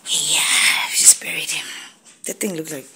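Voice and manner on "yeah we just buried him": hushed funeral whisper. Real, not acted. Mother whispers to viewers that the bug was buried. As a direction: breathe it like sad news at a graveside.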